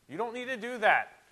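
loud, high, emphatic pulpit voice; full-force preaching. Real, not acted. Preacher rebuking man-made doctrines, full-force preaching. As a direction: hammer it home from the pulpit.

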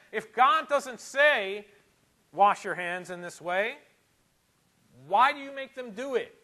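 loud, high preaching with slow, weighted words; forceful preaching. Real, not acted. Preacher putting Jesus' question to his congregation, forceful preaching. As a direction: press the question hard, at full pulpit volume.

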